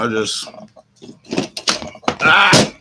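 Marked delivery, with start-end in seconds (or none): 2.2-2.7 sudden high, loud yelp; a quick real yelp of alarm; startled yelp. Real, not acted. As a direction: cry out in surprise. Sudden yelp while crafting.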